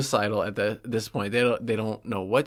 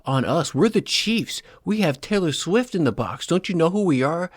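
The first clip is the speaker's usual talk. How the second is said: higher, hammed-up snooty outrage; mocking entitled Chiefs; 5 semitones higher than usual. Bengals fan mocking the Chiefs' entitlement, high sneering voice. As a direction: play the spoiled star demanding respect.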